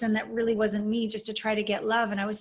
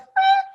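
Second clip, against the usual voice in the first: frustrated outburst; 10 dB louder than usual. Retold or imitated. Imitating urge to close heart.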